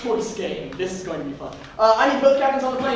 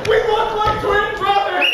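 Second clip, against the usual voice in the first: excited; 9 semitones higher, 4 dB louder than usual. Acted. Excited actor in scene.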